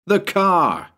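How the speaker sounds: high, urgent cry; urgent shout. A narrator's reading. Narrator shouting to Bill while fleeing a ghost, urgent cry. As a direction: shout it in a panic.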